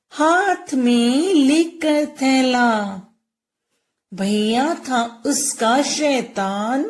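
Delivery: put-on slow singsong rhyme for children; lilting, very slow teacher's recitation; poem recitation. Shown as a demonstration. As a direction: draw out each word of the verse. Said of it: "ए की मात्रा की कविता करेंगे".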